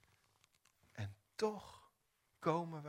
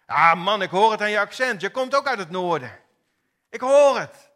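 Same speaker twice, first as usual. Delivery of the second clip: shouted, much higher and fast; triumphant accusation; 6 semitones higher, 18 dB louder than usual. Acted. Bystander shouting at Petrus that his northern accent gives him away. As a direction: nail him, you've caught him out.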